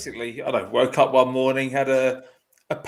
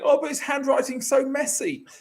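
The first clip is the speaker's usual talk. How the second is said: mock nurse shout; 11 semitones higher than usual. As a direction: squeal it like a panicking nurse. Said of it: "this nurse is shouting".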